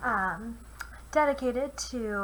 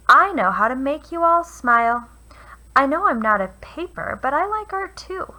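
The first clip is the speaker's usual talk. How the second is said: kind stone offering; 3 semitones higher, 10 dB louder than usual. Acted. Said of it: "and said".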